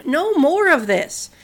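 slow, loud command; shouted. Acted. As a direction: stop the fight with a firm cry. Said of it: "He shouted".

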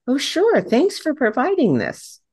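high, eager thank-you; agreeable reply. Retold or imitated. Introducer answering a speaker who hands over a bio, high and agreeable. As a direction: accept brightly, pitched well up.

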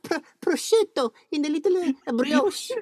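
a bad Italian cartoon plumber voice, way up high; Mario voice. To the waiter, ordering in a hammed-up Italian accent. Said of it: "I put on my best Mario".

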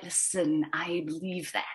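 a slithery low menace, almost breathed; quiet slithery Clawdite bounty hunter. Shown as a demonstration. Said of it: "very slithery".

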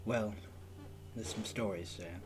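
quiet, guarded, somber; holding back painful memories. Acted. Character declines to say more.

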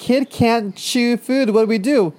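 high, slow goofy parent voice; high silly parent voice. Retold or imitated. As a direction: play a clueless proud parent, high. Mocking parents of buck-toothed kid.

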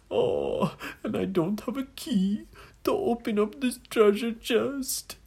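high-pitched, hammed-up wailing; mock sobs between self-pitying laments; crying man. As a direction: wail woe-is-me in a high put-on voice. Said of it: "a sound of a man crying".

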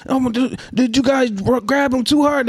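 lightly voiced fake-shocked doctor; mock doctor. Vlogger acting the doctor blaming the parents, mock shock. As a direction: play the doctor faking surprise. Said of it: "the doctor's gonna come in".

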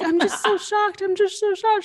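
high, put-on clueless Karen; mock Karen voice. Imitating a comedian's Karen, a high put-on shocked voice. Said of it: "conversations with Karen".

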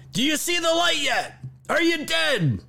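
high mocking voice acting out the host; hammed-up high voice; mock screaming. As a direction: squeal it in a cruel parody. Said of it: "what he was screaming to the intern".

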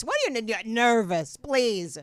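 loud, dismissive retold retort; scornful disbelief. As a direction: scoff loudly at the very idea. Scoffs at friend's claimed nerves.

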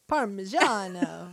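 slow, savored Italian pronunciation; Italian accent. Retold or imitated. Imitating the cook speaking to people in Italy, Italian accent. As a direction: draw it out in a mock Italian accent. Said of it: "that's when she'll be like".

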